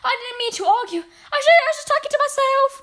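very high, loud, racing excuse; frightened witch crying out. A narrator's reading. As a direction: babble it in a panicked squeal. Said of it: "the witch cried out".

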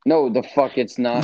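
usual speaking voice

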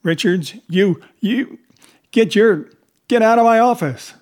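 high, loud sputtering that bursts into a yell; spluttering boss shouting someone out; flustered rant. A narrator's reading. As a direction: stammer in fury, then explode. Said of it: "Flustered, he made a poor attempt at maintaining his former rant".

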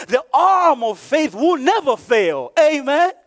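rising, driven pulpit delivery; preaching. Real, not acted. Preacher tells congregation that humans are limited, preaching. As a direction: preach it out in emphatic, rolling phrases.

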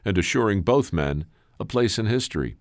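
measured, dramatic storyteller's read with weighty pauses; story narration. A narrator's reading. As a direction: narrate it like a tale building to its reveal.

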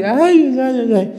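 high swooning sighs; mock swooning girls. Girls sighing over the seminarian, mock swooning. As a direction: sigh like smitten girls.

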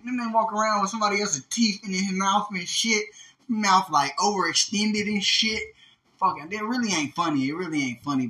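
ordinary talk, nothing marked

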